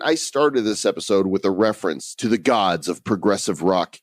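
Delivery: ordinary delivery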